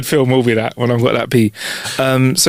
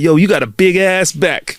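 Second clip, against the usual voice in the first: slow, drawn-out street guy; mock catcall from a stranger in a shop; imitating the dude; 5 semitones higher, slower than usual. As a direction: drawl it like a cheeky stranger. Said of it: "he was like".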